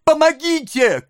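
put-on very high child's scream for help; desperate cry. A narrator's reading. Oliver struggling in mighty hands, desperate cry. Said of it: "крикнул Оливер".

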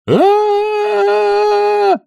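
long high sustained scream; crowd scream. A narrator's reading. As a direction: hold one shrill crowd-like scream. (долгий пронзительный вопль)

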